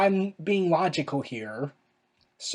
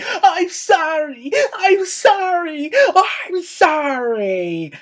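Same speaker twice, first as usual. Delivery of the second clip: high, slow, loud mocking scream; mocking a wrestler's screamed apology, high and slow; 11 semitones higher, 7 dB louder, much slower than usual. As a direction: ham up her shrieking apology. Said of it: "where she's screaming".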